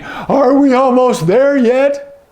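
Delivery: high drawn-out are we there yet; whiny kid. Acted. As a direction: whine like a kid in the back seat. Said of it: "the kids in the back are saying".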